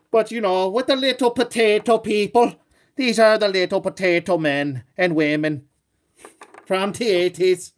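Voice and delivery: put-on a deliberately terrible Irish accent, slowing at the end; bad Irish accent. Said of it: "my horrible Irish accent".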